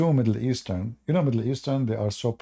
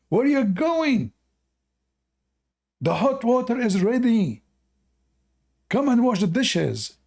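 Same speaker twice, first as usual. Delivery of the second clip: high, loud nagging wife, even more hammed up; bossy wife; 11 semitones higher, 3 dB louder than usual. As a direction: shout him back to wash the dishes. Wife's line repeated for the punchline.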